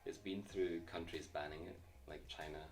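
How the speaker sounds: ordinary delivery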